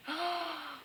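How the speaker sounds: high, soft gasp; shocked gasp. Real, not acted. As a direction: suck in breath in dismay. Shocked at sad illustration.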